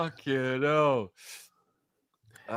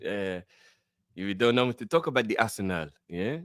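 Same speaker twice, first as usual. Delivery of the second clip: softer put-on French accent; 3 semitones lower, 7 dB quieter, faster than usual. Host turning from Arsenal to Liverpool, mock French accent. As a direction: trail on in mock French English. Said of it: "do the show in a French accent".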